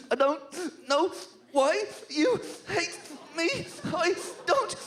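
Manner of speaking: high, wailing mock sob of a child; hammed-up child crying through the words; mock sobbing kid. Kid wailing to his seat neighbour, mock sob. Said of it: "started crying those kind of tears".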